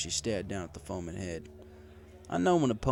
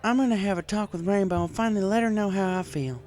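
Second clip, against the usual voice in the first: higher, louder mare voice, resolved; declaring farm mare; 11 semitones higher, 6 dB louder than usual. A narrator's reading.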